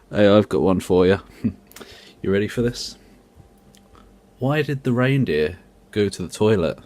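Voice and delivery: ordinary talk, nothing marked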